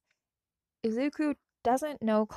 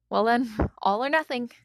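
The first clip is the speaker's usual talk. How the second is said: daring half-laugh; nervous laugh; 3 semitones higher, 9 dB louder than usual. Acted. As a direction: laugh it off before the leap. Izuku laughs before the plunge.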